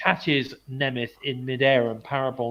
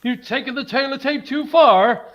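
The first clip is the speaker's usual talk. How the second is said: higher, louder hammed-up warning; 12 semitones higher, 7 dB louder than usual. Host parodies [name]'s line to tease co-host. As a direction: throw the catchphrase back as a joke. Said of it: "You've stepped over the line now".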